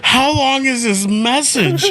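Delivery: high, laughing-edged telling; real amused excitement; excited. Real, not acted. Laughing retelling of message.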